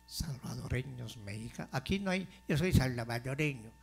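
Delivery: usual speaking voice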